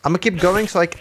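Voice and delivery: ordinary talk, nothing marked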